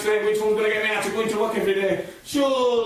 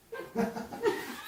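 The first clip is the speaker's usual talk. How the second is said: airy laugh; 12 semitones higher, 12 dB quieter than usual. Real, not acted. Laughing at own options.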